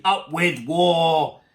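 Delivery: loud, very slow war cry; shouting. A narrator's reading. Tyrannosaurs cross the river, loud drawn-out shout. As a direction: bellow it, stretching each word. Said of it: "And they shouted".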